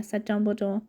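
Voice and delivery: plain reading voice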